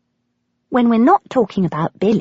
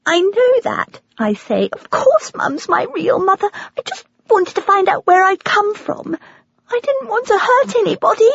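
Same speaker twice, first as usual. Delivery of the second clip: much higher, tearful voice breaking; crying; 14 semitones higher than usual. A narrator's reading. Granddaughter hurt by harsh words, speaking through tears. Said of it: "Suddenly, I'm crying".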